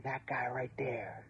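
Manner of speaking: hushed, secretive whisper pointing someone out; whispered betrayal. Retold or imitated. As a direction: whisper it like an informer turning you in.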